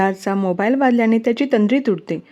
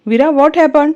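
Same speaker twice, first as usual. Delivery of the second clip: high, raised-voice alarm; alarmed shout; 6 semitones higher, 4 dB louder, slower than usual. Acted. Virat rushes to Veera seeing her hand, alarmed shout. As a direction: call out in worried shock. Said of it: "तो घाबरून तिच्या जवळ येतच ओरडून बोलतो".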